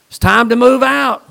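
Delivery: a preacher bursting out with the command to move; shouted call. Acted. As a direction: proclaim it full force to the congregation. Preacher repeats line, then shouts it.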